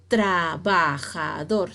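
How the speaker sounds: "trabajador" as tra, ba, ja, dor; split into syllables. Shown as a demonstration. Teacher showing a divided word, "trabajador" syllable by syllable. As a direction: slowly separate each syllable of "trabajador". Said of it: "se ha dividido en sílabas".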